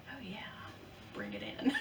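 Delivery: very soft, mock-sneaky aside; secretly giving in. Vlogger admitting she now wants the bag, hushed mock-secret. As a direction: murmur it like a guilty confession. Acting out secret change of mind.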